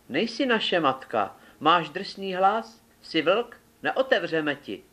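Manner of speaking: high little voices pushing the wolf away; frightened kids insisting through the door; little kids answering. A narrator's reading.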